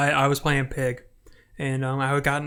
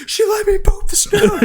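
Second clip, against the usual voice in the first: very high excited squeal, louder; hyped squeal; 6 dB louder than usual. Retold or imitated. Player retelling getting hyped after the boop, high squeal. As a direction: squeal it in delight. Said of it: "I was like".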